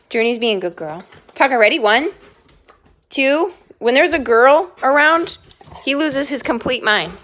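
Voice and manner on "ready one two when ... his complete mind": ordinary talk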